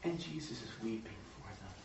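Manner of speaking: low, soft, solemn delivery; hushed narration. A narrator's reading. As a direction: drop right down after the clamor. Hushed contrast after crowd shouting.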